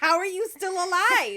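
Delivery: loud, high amazed outburst; shocked disbelief. Retold or imitated. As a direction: blurt it out in disbelief. Retold shock at husband's walk.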